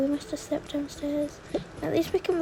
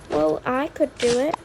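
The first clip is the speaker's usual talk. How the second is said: ordinary delivery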